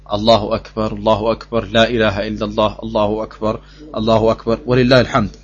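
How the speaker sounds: low, even chant-like recital; murmured liturgical formula on one low note; Takbir recitation. Shown as a demonstration. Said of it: "den Takbir".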